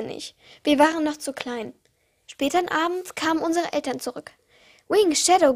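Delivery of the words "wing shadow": calling. A narrator's reading. Parents calling their chicks by name.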